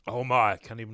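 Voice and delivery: choking on a sip of water; coughing up water. Real, not acted. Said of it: "water went down the wrong way".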